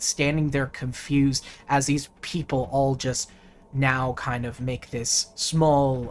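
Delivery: usual speaking voice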